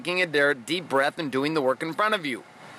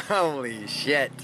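ordinary delivery

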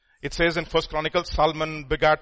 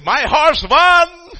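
much higher and louder, slow victory cry; triumphant shout; 12 semitones higher, 14 dB louder, much slower than usual. Retold or imitated. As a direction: crow over winning the bet. Prisoner cheering his bet in dark.

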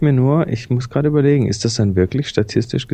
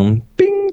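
a high, sung bing like a chime; ping it out like a game-show bell; high bing sound. Pretend. Random generator result sound.

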